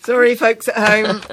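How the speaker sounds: real giggles while saying sorry; laughing apology. Real, not acted.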